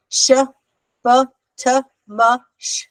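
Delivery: "Sh, b, t, m, sh" one by one, with pauses; isolated letter sounds. Shown as a demonstration. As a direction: sound out "Sh, b, t, m, sh" as short separate consonants, no ah. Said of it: "I put an ah after everything".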